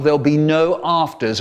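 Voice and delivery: ordinary delivery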